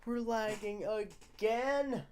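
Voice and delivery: high, slow, drawn-out whine; high-pitched dismayed groan of words; exasperated complaint. Real, not acted. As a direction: stretch it out high in frustration. Exasperated at stream lag.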